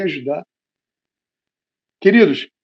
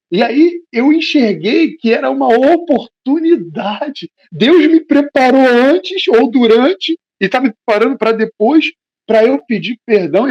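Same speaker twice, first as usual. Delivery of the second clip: fervent preaching; 8 semitones higher, 6 dB louder, slower than usual. Acted. Preacher rising to fervour.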